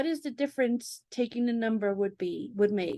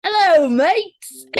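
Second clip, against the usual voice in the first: loud, slow, hammed-up Australian accent; laughing through a mock Australian bogan greeting; broad mock Australian accent to a friend; 6 semitones higher, 11 dB louder, much slower than usual. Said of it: "Pretend you're a bogan Australian".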